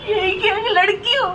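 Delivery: sobbing, wounded protest; real crying. Real, not acted. Fatherless woman asking what her fault was, sobbing. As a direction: cry out the grievance in broken phrases. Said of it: "रो क्यों रही है यार तू".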